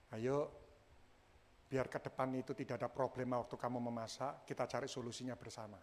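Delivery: low, soft, gentle suggestion; calm and quiet, offering to solve it together; patient, wise wording. Shown as a demonstration. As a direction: coax softly like a patient spouse. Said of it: "orang sabar itu gini, memilih kata-kata".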